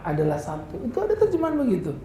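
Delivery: usual speaking voice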